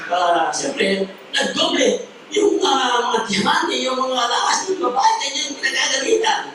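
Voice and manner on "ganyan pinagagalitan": ordinary talk, nothing marked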